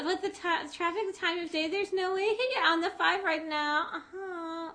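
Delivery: high, whining mock Californian accent; Californian sketch accent. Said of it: "they just talk with that bizarre accent".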